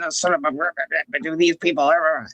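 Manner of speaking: low, imitated grumbling; mock irritated grumble. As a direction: grumble it under pitch, as if to yourself. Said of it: "heard you over there grumbling".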